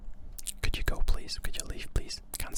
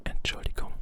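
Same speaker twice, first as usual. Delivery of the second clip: ordinary delivery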